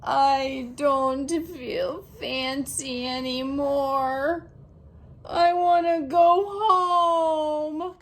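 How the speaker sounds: slow, tearful whimper; sad and teary. A narrator's reading. Girl after spilling dessert, slow and teary. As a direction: let the tears through.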